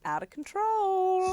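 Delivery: high, drawn-out complaint; mock outrage. Pretend complaint to a niece's parents, mock outrage. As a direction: sing it out high and slow in mock dismay. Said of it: "look straight at her mum and dad and go".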